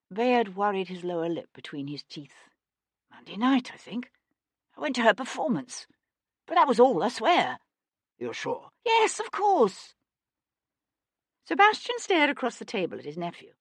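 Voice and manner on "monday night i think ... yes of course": nervous. Acted. Bayard nervous, insisting.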